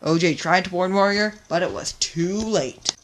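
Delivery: ordinary delivery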